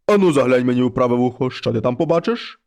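low, heavy voice of a wolf head at the door; low monster head voice. Acted. Wolf head knocks and calls the girl, low and heavy.

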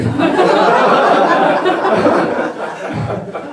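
high, breathy burst of laughter; bright laugh. Real, not acted. Preacher laughing at his own mall story, bright laugh. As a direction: laugh at your own failed guesses.

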